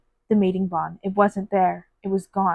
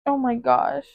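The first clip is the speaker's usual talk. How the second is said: ordinary talk, nothing marked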